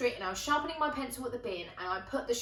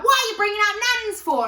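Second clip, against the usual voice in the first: fast, higher and louder, hammed-up offence; mock indignant outburst; 11 semitones higher, 11 dB louder, faster than usual. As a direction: fire it back at him in mock outrage. Mate's angry retort retold.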